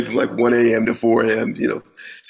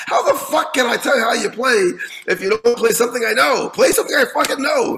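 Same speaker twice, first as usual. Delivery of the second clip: angry mentor; 9 semitones higher than usual. Retold or imitated. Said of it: "He says".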